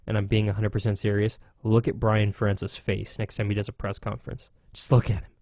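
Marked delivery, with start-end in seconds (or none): none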